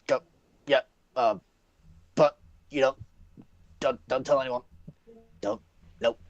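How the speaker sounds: high, halting shopkeeper voice; nervous shopkeeper. Acted. Shopkeeper excusing a mix-up to threatening customer, nervous.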